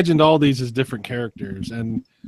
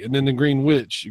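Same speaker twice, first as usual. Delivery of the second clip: ordinary talk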